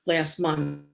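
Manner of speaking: usual speaking voice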